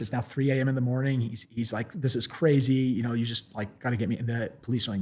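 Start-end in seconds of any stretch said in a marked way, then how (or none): none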